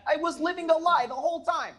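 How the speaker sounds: high, loud wailing lament; hammed-up anguish; mock despair. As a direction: play the ruined man crying out. Husband's dramatic realization.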